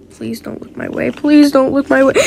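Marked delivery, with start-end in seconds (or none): 0.1-1.1 breathy unvoiced whisper, much softer. Character begging not to be noticed, whispered. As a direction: whisper it so nobody notices you. Same line read twice.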